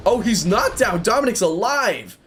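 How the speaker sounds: high, loud joyful relief; excited. Real, not acted. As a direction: cheer him back up. Ally survives in game.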